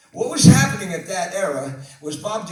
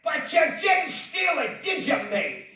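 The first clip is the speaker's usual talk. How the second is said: overjoyed [name]; 11 semitones higher than usual. Retold or imitated. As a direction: shout your love at him in a British voice. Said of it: "he grabs me".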